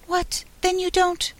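very high, tiny insect voice; little voice. A narrator's reading. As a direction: start up in a small, high voice. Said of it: "the little voice began".

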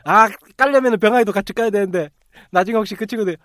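ordinary talk

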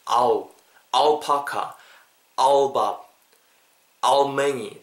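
"아우파카, 아우밥" with 아우 standing for a dark al; dark-l demo. Shown as a demonstration. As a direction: say "아우" words one by one with pauses. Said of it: "그 느낌으로 발음해주세요". ('아우파카, 아우밥'처럼 '아우'를 살려서)

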